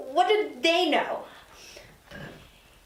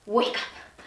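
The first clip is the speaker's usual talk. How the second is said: whispered plea; 6 semitones lower than usual. Acted. Pinches herself, hoping it's nightmare.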